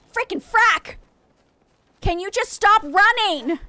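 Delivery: high, loud yell at the running dog; exasperated yell. Real, not acted. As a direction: shout after a runaway dog.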